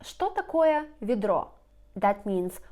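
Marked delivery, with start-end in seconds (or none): 0.0-1.5 raised question. Shown as a demonstration. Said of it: "you can ask somebody".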